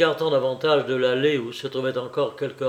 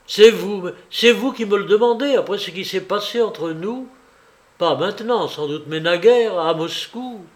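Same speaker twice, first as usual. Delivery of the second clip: loud, higher, indignant outburst; angry and raised, easing slightly towards the end; surge of anger; 5 semitones higher, 6 dB louder than usual. A narrator's reading. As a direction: throw the accusation back angrily. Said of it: "Un mouvement de colère lui étreignit".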